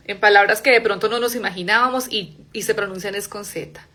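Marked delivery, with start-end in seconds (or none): none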